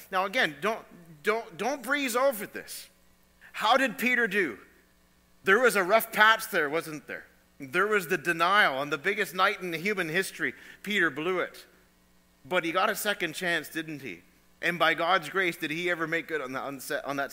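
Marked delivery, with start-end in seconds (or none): 0.1-11.7 loud, high, pressing hard; preaching at full force. Real, not acted. Preacher urging the church not to breeze over it, loud. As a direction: hammer it home from the pulpit.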